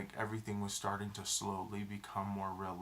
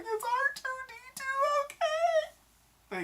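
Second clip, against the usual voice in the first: very high, fast, squeaky voice, louder on okay; squeaky worried girl; 5 dB louder than usual. Retold or imitated. Mocking a girl crying at Star Wars, squeaky worried voice. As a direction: ask it like a fretting little kid. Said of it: "She's like".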